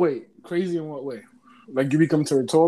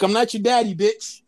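louder, higher harsh comeback; harsh retort; 6 semitones higher, 4 dB louder than usual. Acted. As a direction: put them down harshly. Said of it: "That's a lot harsh".

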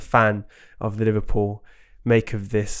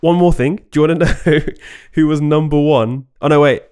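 louder, higher, playful teasing; 4 semitones higher, 6 dB louder than usual. Real, not acted. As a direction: needle the fan. Teasing a Liverpool fan.

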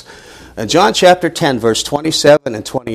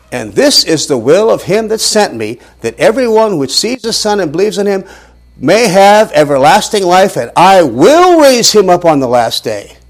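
rising, slowing, louder reading; emphatic scripture reading; 5 semitones higher, 5 dB louder than usual. Acted. Preacher reading the verse to his class, building emphasis. Scripture read aloud with force.

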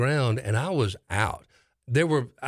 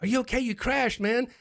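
friends asking; 14 semitones higher than usual. Retold or imitated. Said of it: "They go".

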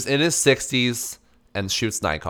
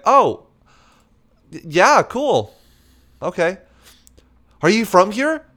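forced politeness; 8 semitones higher, 4 dB louder, slower than usual. Retold or imitated. Fake polite small talk.

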